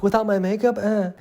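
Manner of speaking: put-on high, loud, appalled ditzy girl; mock bimbo. Friend imitating the film girl worrying about makeup, high mock gasp. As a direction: gasp it like a vain airhead. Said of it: "She's like".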